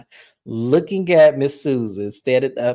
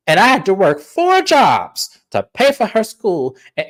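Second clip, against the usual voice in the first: higher, louder rant, half-played; mock angry mom; 6 semitones higher, 5 dB louder than usual. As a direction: rant like an offended mother. Mom's angry rant retold.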